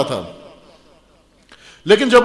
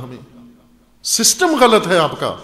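usual speaking voice

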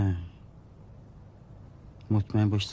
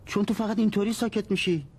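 much higher, heated retort; irritated yelling; 10 semitones higher than usual. Acted. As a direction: fire back in a raised, exasperated pitch.

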